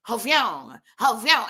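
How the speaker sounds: mouthed zoom noise; cars whooshing past. Retold or imitated. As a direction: mimic a car zooming by, high then sliding down. Imitates cars whooshing past again.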